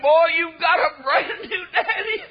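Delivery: high, slow, emotional cry; imitating an overjoyed, tearful father; choked-up joy. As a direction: let the voice climb as if near tears. Tearful father quoted at story's climax.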